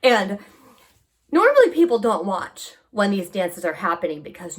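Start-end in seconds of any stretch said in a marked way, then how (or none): none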